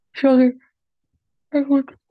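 talking through a yawn; words stretched by a yawn. Real, not acted. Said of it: "even gapen".

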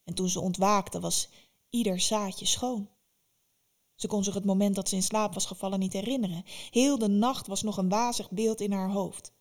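usual speaking voice

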